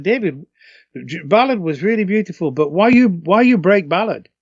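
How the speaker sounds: a hint of a Japanese accent, pleading; Japanese fans. Singer imitating upset Japanese fans, a mild Japanese accent. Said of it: "we go to Japan and they're really upset".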